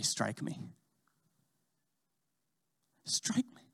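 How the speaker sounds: soft, then a breathy whisper; whispered plea. Retold or imitated. Christ on the cross inviting the listener, whispered. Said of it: "you hear him say".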